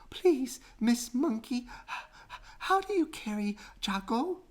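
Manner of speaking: out of breath from hurrying, squeaking. A narrator's reading. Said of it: "she managed to squeak".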